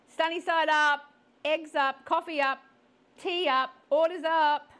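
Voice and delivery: high, loud cafe calls; calling out orders. Acted. Waitress calling orders over the breakfast rush, loud and high. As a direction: call the orders across a busy cafe.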